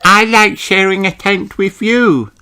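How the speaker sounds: put-on high little sheep girl voice; Susie's voice. A narrator's reading. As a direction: pipe it up high like a small lamb. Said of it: "says Susie".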